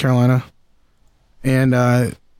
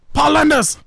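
mock yell; 11 semitones higher than usual. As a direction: shout the name as if announcing himself on stage. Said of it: "He always sounds like he's yelling".

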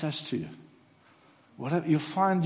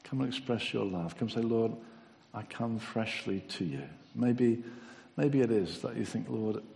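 altar-call prayer; 4 dB quieter than usual. Acted. Preacher inviting the congregation to come and pray, softly. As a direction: gently invite them forward.

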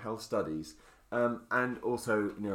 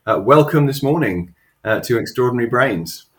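loud, bright show-host welcome; announcer welcome; 17 dB louder than usual. Acted. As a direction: boom it out like a host. Big show welcome to guest.

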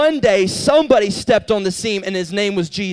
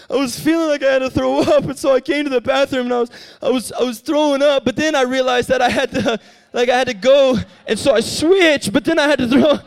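put-on higher, whiny kid voice; a sick boy owning up to his pastor in a higher voice; sheepish boy; 4 semitones higher than usual. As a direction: explain the mess like an embarrassed boy. Boy confessing his sickness.

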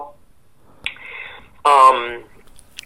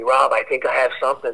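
usual speaking voice

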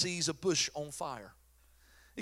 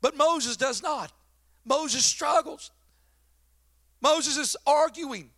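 slow, loud, higher, each word driven home; slow emphatic preaching; 7 semitones higher, 5 dB louder, much slower than usual. Shown as a demonstration. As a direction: hammer home that Moses argues. Preacher stresses Moses arguing.

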